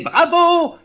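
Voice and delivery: loud, high shouted bravo; crowd's cheer. A narrator's reading. The whole crowd cheering the clown, loud shout. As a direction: cry out like an applauding crowd. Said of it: "Tout le peuple criait".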